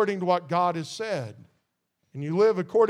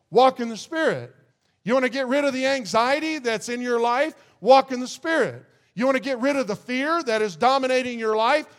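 higher, louder revival-preacher drive; full-force preaching; 4 semitones higher, 4 dB louder than usual. Real, not acted. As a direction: build it up like a call to the congregation.

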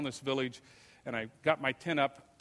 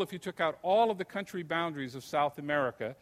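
usual speaking voice

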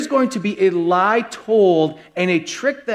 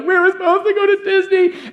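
put-on high, laughing whiny-child voice; whining kids; 12 semitones higher, 3 dB louder than usual. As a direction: play the disappointed kids, wailing high with a laugh. Kids melted on the floor whining.

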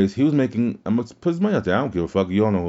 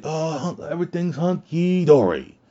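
higher, sing-song mock cheer; lightly mock coworkers pretending all is fine; mock cheery; 7 semitones higher than usual. Mocking coworkers at the bar who say work is great, in a higher sing-song mock cheer. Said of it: "You guys just come out and say".

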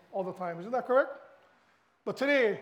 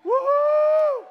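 very high, loud whoop; fan whoop; 14 dB louder than usual. Real, not acted. Football fan cheering his Rainbow Warriors, loud high whoop. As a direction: let rip like in the stands.

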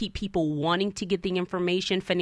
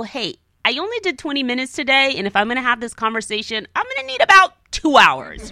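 rising higher and louder, mock-exasperated; exasperated quote; 7 semitones higher, 8 dB louder than usual. Telling people she needs two hours first, mounting mock exasperation. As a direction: ham up the demand for more meditation time. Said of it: "Sometimes I tell people".

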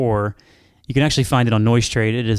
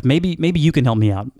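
normal talking voice

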